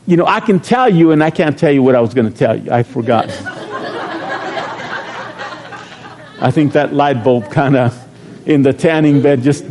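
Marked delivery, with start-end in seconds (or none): 3.3-5.7 wheezy unvoiced laugh at himself; breathless silent laugh. Real, not acted. Pastor laughing at forgetting his point, silent wheeze. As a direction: laugh at yourself, no voice.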